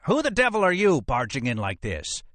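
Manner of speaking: indignant cry. Acted. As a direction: cry out in outrage, pitch soaring. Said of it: "he cried".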